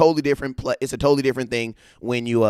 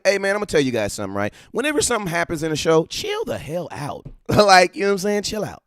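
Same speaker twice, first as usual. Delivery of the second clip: ordinary talk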